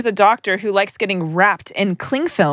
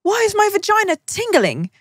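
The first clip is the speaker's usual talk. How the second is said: put-on much higher, childlike asking; childish question; 11 semitones higher than usual. Child asking grandma a naive question. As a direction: ask it like a puzzled little girl. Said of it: "and asked".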